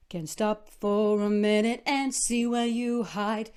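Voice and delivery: elevated volume. Shown as a demonstration. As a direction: lift the volume up. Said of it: "We've elevated".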